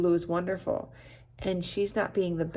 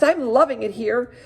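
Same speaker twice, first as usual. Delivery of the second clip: happy announcement; 6 semitones higher, 7 dB louder than usual. Acted. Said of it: "happily announced".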